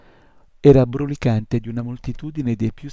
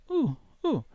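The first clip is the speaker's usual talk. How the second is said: high squeaky imp sound; stumped little creature; puzzled imp; 14 semitones higher, 4 dB quieter than usual. A narrator's reading. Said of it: "domandò l'incubino". (versetto acuto e perplesso)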